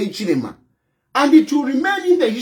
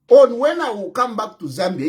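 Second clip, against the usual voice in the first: ordinary talk, nothing marked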